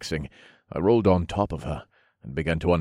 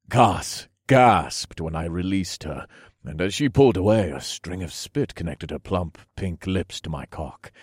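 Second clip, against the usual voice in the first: plain reading voice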